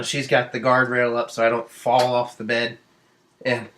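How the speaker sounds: ordinary talk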